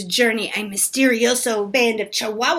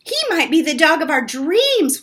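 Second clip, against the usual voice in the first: ordinary delivery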